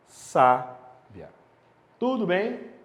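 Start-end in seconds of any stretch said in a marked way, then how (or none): 0.1-1.3 "Sábia" as SÁ... bia, first syllable loud; "Sábia" split in two, strong long "Sá"; stress on penultimate syllable. Shown as a demonstration. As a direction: punch the "Sá" of "Sábia", then let "bia" drop. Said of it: "está na penúltima sílaba".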